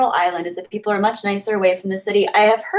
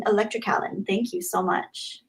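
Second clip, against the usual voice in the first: normal talking voice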